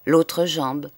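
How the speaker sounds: usual speaking voice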